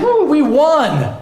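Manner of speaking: devil's mock victory cheer. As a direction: crow over the win like a gloating devil. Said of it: "He was cheering on like".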